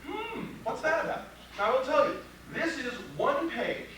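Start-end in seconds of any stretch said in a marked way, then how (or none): 0.0-1.3 higher, wondering hmm as a nosy juror; curious juror voice. Retold or imitated. Lawyer imitating jurors curious about the poster, higher and wondering. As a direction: play the intrigued juror leaning in. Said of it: "Everybody goes".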